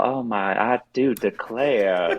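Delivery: slow, drawn-out genteel Southern belle; genteel Southern accent. Shown as a demonstration. Guest shows hosts the range of accents, genteel Southern accent. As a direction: draw it out like a refined Southern lady. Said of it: "You've got the, like, Southern genteel".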